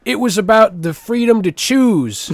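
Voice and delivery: indignant Southern drawl, pitched high; Southern accent. Acted. Excusing the war to co-host, mock Southern accent. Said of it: "do a proper Southern accent".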